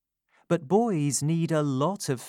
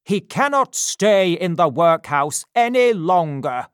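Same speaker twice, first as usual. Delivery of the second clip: shouting; 5 semitones higher, 9 dB louder than usual. Acted. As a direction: bellow it in outrage. Bumble declares loudly.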